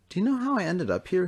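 high, puzzled question; Thomas asking. A narrator's reading. Thomas asks the director, high and bewildered. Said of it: "he asked".

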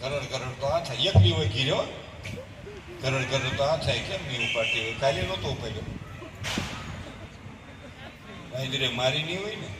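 low, hushed, anxious mother muttering to herself; worried mother demo. Acted. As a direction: play a fretful mother whose daughter won't pick up. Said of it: "જરાક demo બતાવું છે". (ચિંતાતુર માતાનો ધીમો, ગભરાયેલો અવાજ)

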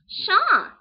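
high, loud dying squawk; sudden shrill Harley cry; overacted death scene. Shown as a demonstration. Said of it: "the death scene at the end".

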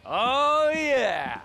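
big, loud, rising whoop; gloating exclamation. Acted. Businessman gloating at the man who refuses, loud whoop. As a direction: crow it out, high and loud. Cocky villain answers a refusal.

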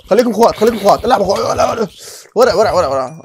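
high, loud sarcastic begging; mock pleading shout. Real, not acted. Gamer loudly begging attacking zombies to play nice. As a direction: shout at the mobs as if pleading for peace.

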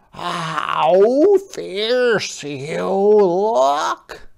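high, slow, gentle crab voice; a small polite sea creature asking; Hermit Crab voice. Acted. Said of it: "said Hermit Crab".

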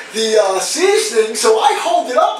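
ordinary talk

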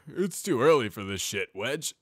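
tired, grouchy stallion; grumpy older brother. Acted. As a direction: grumble like a sleepy sergeant. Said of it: "I'm tired and grumpy".

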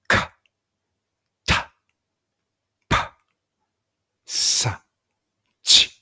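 か、た、ぱ、さ、ち each with a strong puff of breath; forceful tense consonants. Shown as a demonstration. Learner reading Korean tense consonants, か、た、ぱ、さ、ち strongly exhaled. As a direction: fire off か、た、ぱ、さ、ち one by one, hard-hit consonants. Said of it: "息を強く吐きながら発音する音です".

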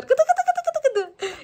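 very high squeaky tickling coo, slow. Real, not acted. Mother tickling the baby again. (çok ince, yavaş gıdıklama sesi)

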